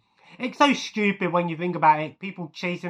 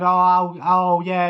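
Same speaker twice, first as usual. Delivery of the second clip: loud, mildly indignant retelling; a loud, half-hearted angry groan of protest; mock anger; 7 dB louder, slower than usual. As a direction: imitate angry card players protesting loudly. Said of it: "they're all getting angry at him".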